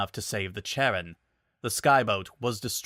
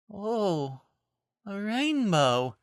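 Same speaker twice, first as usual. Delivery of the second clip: slow, much higher dreamy daze; woozy; 10 semitones higher, much slower than usual. Acted. Concussed Twilight sees Rainbow's hair, woozy. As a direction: sound half conscious. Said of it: "she said woozily".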